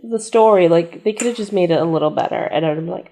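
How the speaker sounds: normal talking voice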